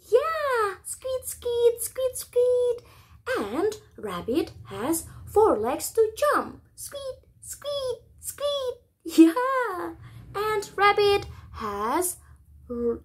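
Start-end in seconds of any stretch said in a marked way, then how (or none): 0.8-2.8 high squeaky squeaks, lightly voiced; high playful squeaks for children; rabbit squeak. Shown as a demonstration. Teacher imitates rabbit. 6.7-8.7 high, much softer tiny squeaks; hushed high bunny squeaks for children; rabbit squeak. Shown as a demonstration. Teacher imitates rabbit softly.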